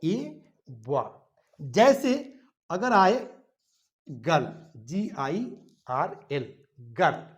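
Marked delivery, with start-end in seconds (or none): 0.0-1.3 "ए Buwa" said very slowly and softly; slow model answer. Shown as a demonstration. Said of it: "तो इसके पहले हम क्या कहेंगे". 4.1-7.3 slow spelling. Shown as a demonstration. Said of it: "तो देखिए तो गर्ल".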